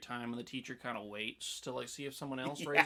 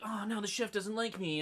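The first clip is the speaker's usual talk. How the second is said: higher-pitched mock fretting; mock dismay; 8 semitones higher than usual. Imitating diner upset that chef dislikes him, mock dismay. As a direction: imitate a wounded fan, pitched up.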